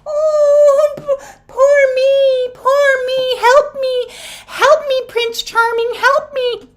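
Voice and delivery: very high, whiny mock maiden; mock the helpless princess begging; damsel in distress. Reader mocking a fairy tale maiden begging Prince Charming. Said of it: "little maiden in distress".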